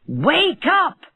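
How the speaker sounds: angry shout. Acted. Rich man yells at the dwarf by his door, angry shout. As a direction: bark him awake. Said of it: "he shouted angrily".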